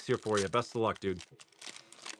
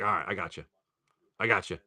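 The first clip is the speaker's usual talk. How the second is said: softer, lower easy agreement; soft obedient reply; 6 semitones lower, 7 dB quieter, faster than usual. Pretend. Viewer answers imagined newscaster, soft and low. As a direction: answer the forecaster meekly. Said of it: "I'll be like".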